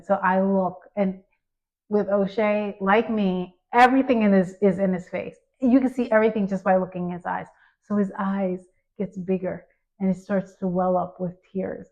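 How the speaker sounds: ordinary delivery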